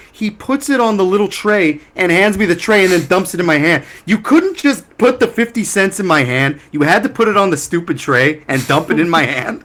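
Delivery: worked-up, incredulous complaint; exasperated rant. Real, not acted. Ranting about change on tray.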